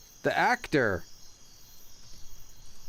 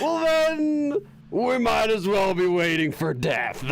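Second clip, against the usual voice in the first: very slow, high, drawn-out words; dramatic game character; 8 semitones higher, 4 dB louder, slower than usual. Acted. Streamer voicing a game line, high and drawn-out. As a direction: wail it slowly and theatrically.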